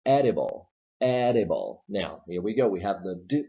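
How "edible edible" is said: "edible" with a tapped d; teacher model of "edible"; flap D model. Shown as a demonstration. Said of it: "Example number three".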